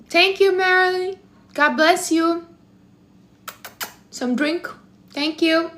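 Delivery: delighted high-pitched gratitude; gushing thanks. Real, not acted. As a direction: beam out a thank-you to Marilyn in a high voice. Thanking a viewer for a donation.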